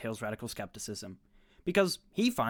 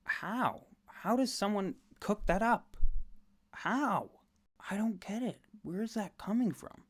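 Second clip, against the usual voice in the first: higher, baffled disbelief; incredulous; 7 semitones higher than usual. Real, not acted. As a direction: ask it like you truly can't fathom it. Baffled by the bad inference.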